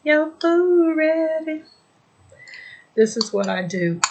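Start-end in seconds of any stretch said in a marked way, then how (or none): 0.0-1.6 high, put-on child's voice; squeaky imitation of a kid calling; imitating Kitten. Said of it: "Kitten was up talking about".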